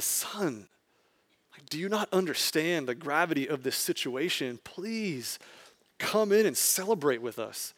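softly pleading father, gentle and patient; soft, patient fatherly plea; calm, composed plea. Retold or imitated. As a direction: coax the son in quietly, no anger. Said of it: "It's the calm, it's the composure".

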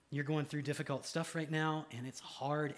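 ordinary talk

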